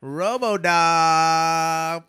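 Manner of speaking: drawn-out emcee announcement. Acted. Emcee announces stage name.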